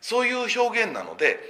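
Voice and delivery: usual speaking voice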